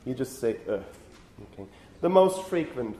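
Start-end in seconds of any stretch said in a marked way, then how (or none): 0.7-0.8 "Uh" with tongue and chin left idle; a brief, effortless schwa on "Uh"; lazy schwa. Shown as a demonstration. Teacher showing you don't do anything, "Uh" as a slack schwa. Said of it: "you don't do anything".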